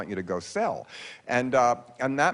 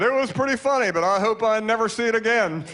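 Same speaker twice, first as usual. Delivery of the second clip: higher, louder mock old-father voice; father's verdict; 7 semitones higher, 4 dB louder than usual. Comedian quoting his father's review after the premiere, mock dad voice. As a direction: imitate an elderly dad giving his blunt review. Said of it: "my father had the best review".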